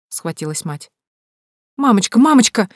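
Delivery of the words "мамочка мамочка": high, loud, fussing alarm; flustered. A narrator's reading. Sister fussing over ailing mother, flustered. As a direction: fuss over her in alarm. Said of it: "засуетилась сестра".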